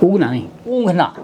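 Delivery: "ऊँघना" with a clear nasal ऊँ and ङ before घ; nasal correction. Shown as a demonstration. Teacher correcting himself, saying "ऊँघना" with a nasal ऊँ. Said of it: "sorry".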